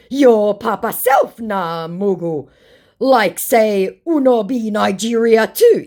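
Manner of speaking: slow, loud, high scammer impression; mock angry scammer. Scammer insulting the baiter back, mock angry. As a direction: drag out the insult in a put-on voice. Acting scammer's pidgin comeback.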